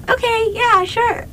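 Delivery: very high-pitched, naive okay; eager agreement. Retold or imitated. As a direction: go along with it in a tiny high voice. Voices own eager agreement to friends.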